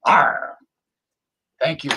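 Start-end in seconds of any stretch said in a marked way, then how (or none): 0.0-0.6 loud pirate growl arr. Pretend. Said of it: "the pirate ship".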